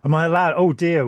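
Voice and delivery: ordinary delivery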